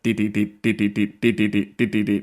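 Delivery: repeated deet sounds mimicking a skipping vinyl record; skipping record noise. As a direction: chant "deet" like a stuck groove, mechanical and even. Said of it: "records being like".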